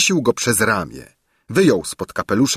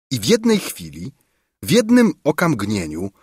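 usual speaking voice

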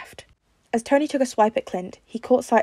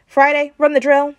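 louder, higher call; 7 semitones higher, 9 dB louder than usual. A narrator's reading. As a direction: call across the gym. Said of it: "Tony called out".

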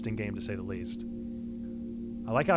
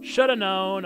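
higher, louder acted take; character voice; 6 semitones higher, 6 dB louder, slower than usual. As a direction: punch it up as a character. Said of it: "I shoulda known".

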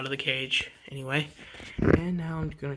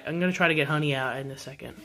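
usual speaking voice

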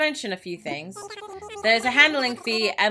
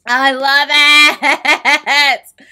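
very loud, drawn-out laughing yell; ecstatic yell; 4 semitones higher, 12 dB louder, much slower than usual. Real, not acted. Gamer gushing over her house, ecstatic laughing yell. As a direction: belt it out slowly, laughing. Overjoyed by the room.

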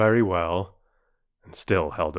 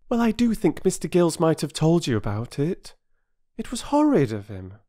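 put-on higher-pitched child grumbling; aggrieved young voice; 10 semitones higher, 4 dB louder than usual. A narrator's reading. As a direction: complain with youthful indignation.

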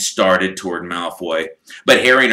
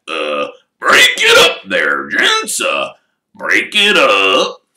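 louder, slow, hammered-out order; 7 dB louder, slower than usual. Acted. Shop assistant ordering the brawlers apart, louder. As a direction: bellow them apart. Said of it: "and then louder than that".

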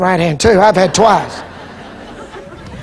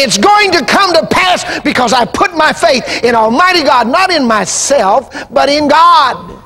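impassioned preaching; 5 semitones higher than usual. Acted. Preaching at full force.

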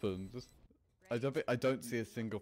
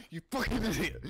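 mock yell; 9 semitones higher, 6 dB louder than usual. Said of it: "You just go".

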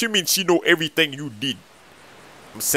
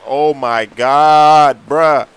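dismayed; 2 semitones lower, 6 dB louder, much slower than usual. Acted. Learns money all spent.

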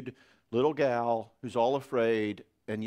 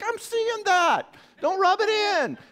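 high, loud hammed-up protest; mock-distressed shout at an inner child; upset shouting; 7 dB louder, slower than usual. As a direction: yell back, drawn out and high. Acted squabble with inner five-year-old.

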